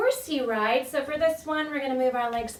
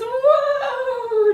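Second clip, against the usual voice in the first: high, loud drawn-out call to the horse; loud whoa call; 13 semitones higher, 10 dB louder than usual. Shown as a demonstration. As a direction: haul on the reins and call out. Pulling reins, calling to horse.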